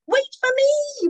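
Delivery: very high, loud, slow shout; a small creature's high, desperate cry. Acted. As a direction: yell after the leaving train. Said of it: "shouted Mincemeat".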